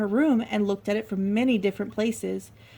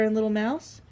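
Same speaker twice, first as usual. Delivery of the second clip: ordinary delivery, nothing marked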